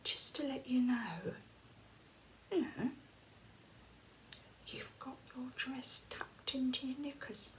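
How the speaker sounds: imitating a discreet whispered warning. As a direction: quietly warn a stranger about her dress. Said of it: "She went".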